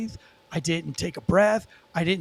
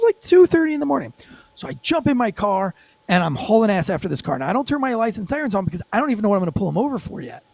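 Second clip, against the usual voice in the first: normal talking voice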